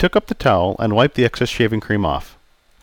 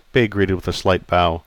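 ordinary delivery

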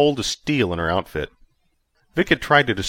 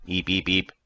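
squeaky little creature chirps; small creature squeak; 3 dB quieter than usual. A narrator's reading. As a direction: chirp it like a tiny alien pet.

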